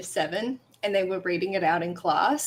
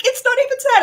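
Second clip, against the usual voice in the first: high, loud mocking of classmates; dismissive classmates; 8 dB louder than usual. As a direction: scoff like a know-it-all teen. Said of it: "And they're like".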